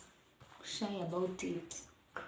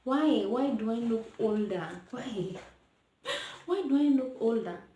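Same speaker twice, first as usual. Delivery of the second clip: exasperated; 3 semitones higher, 5 dB louder than usual. Real, not acted. Exasperated at looking older.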